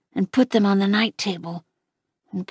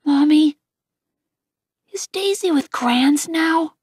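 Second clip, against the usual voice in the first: put-on child's voice, higher pitch; wondering little boy, pitched up; small boy; 6 semitones higher than usual. A narrator's reading. Boy asks Mom about Daisy, higher child voice.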